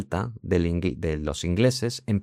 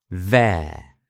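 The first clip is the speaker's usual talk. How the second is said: British there; 2 semitones lower than usual. Shown as a demonstration. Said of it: "En británico".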